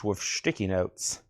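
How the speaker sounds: low, slow, soft Scottish impression with slurred sh in sticky; lightly imitating a Scottish film star, sticky as shticky; Sean Connery. Sorting records on the stream, a slow Scottish impression. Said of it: "that was my best Sean Connery".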